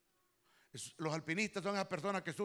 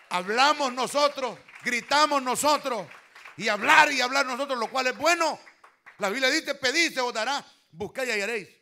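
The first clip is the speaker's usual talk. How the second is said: much louder and higher, hammering each phrase; preaching at full force; 5 semitones higher, 10 dB louder than usual. Acted. Pastor rebuking the congregation, preaching loud.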